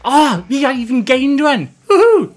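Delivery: loud, high delight; excited. Real, not acted. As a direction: cheer the viewers who stayed.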